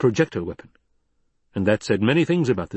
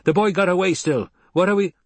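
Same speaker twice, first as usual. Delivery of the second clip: calling out; 9 semitones higher, 3 dB louder than usual. A narrator's reading. Man calls up from basin.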